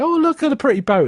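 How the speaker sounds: put-on high, delighted child's voice; kid's voice. Child showing the magic picture, high and delighted. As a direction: squeal it like a little kid. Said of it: "a kid would go up and say".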